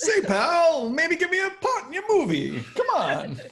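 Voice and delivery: high, loud 1940s Hollywood fast-talker; old-timey producer. Pretend. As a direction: play a fast-talking old studio guy. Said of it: "old-timey voice".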